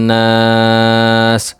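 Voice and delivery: নাস with the long aa held for several beats; drawn-out naas. Shown as a demonstration. Quran teacher stretching the vowel when pausing at the end. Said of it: "ওয়াকফ করলে".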